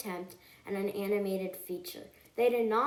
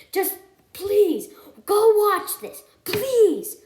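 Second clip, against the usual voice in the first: high, loud begging; urgent, hammed-up plea; desperate pleading; 11 semitones higher, 11 dB louder, slower than usual. Real, not acted. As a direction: beg the viewers at full volume. Begging viewers to watch the film.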